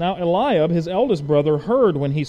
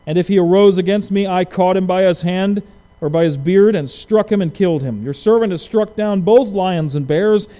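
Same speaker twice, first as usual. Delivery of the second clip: louder, fierce retelling of the fight; bold declamation; 4 semitones higher, 8 dB louder than usual. Acted. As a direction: proclaim the victories with fire. Reading David's boast with force.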